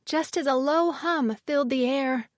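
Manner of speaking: ordinary delivery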